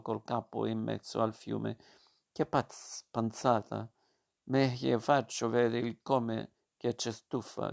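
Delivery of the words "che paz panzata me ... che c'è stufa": ordinary delivery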